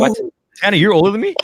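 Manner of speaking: real surprise. Real, not acted. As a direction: blurt it out in high-pitched disbelief.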